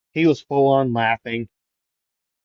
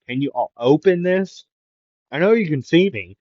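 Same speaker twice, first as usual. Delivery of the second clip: higher, mildly raised plea; half-called demand, pitched up; insistent calling; 5 semitones higher, 3 dB louder than usual. Acted. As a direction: pester them through the glass. Character pleading through the glass.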